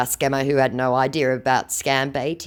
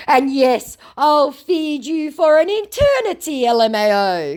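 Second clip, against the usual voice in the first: mock scammer boast; 13 semitones higher, 7 dB louder than usual. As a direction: ham up the scammer's grand nonsense. Voices scammer's nonsensical boast.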